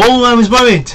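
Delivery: mock worried parent. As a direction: lightly send up the worried voice. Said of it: "I get the whole".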